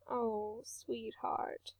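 soft, hushed tender sympathy; mother soothing her crying son in a near whisper; gentle comfort. A narrator's reading.

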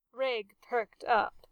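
ordinary delivery, nothing marked

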